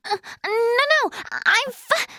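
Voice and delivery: high, stammering protest that breaks off; flustered, cut-off stammer; flustered denial. Acted. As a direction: insist you are fine and falter.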